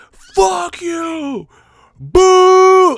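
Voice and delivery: put-on old players booing in outrage; mock angry crowd. Angry crowd at the bingo hall.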